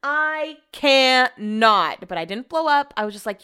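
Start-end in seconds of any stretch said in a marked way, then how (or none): 0.0-2.1 "cannot" drawn out very slowly; slow, emphatic "I cannot"; exasperated emphasis. Real, not acted. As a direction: stretch "I cannot" word by word, fed up. Fed up after the rant.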